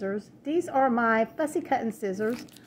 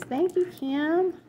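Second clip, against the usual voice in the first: usual speaking voice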